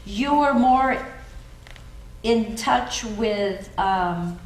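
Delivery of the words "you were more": ordinary talk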